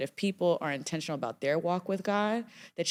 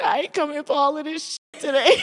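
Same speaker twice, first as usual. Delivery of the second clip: louder, higher laughing protest; laughing refusal; 7 dB louder than usual. Retold or imitated. Client laughingly refuses her therapist's challenge. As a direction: push back while laughing.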